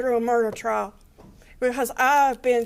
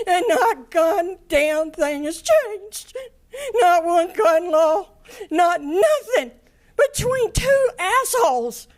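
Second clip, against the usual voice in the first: crying; 7 semitones higher, 5 dB louder than usual. Real, not acted. Mother at the sentencing, crying out about lost family. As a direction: voice breaking, high and loud through real tears.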